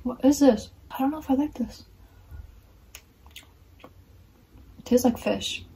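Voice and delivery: biting food mid-sentence; talking while chewing. Real, not acted. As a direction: mouth partly full of snack. Seen 1: Bites and pulls fish strips at lips while talking, then chews.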